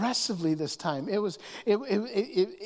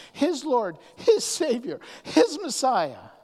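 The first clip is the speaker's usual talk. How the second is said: building emphasis; 6 semitones higher, 5 dB louder, slower than usual. Real, not acted. Rising emphatic list.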